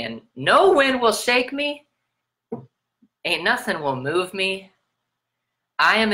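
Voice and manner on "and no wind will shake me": high, ringing affirmation; proud declaration. Acted. Affirming identity to the viewer, lifted high and proud. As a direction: proclaim it like an anthem.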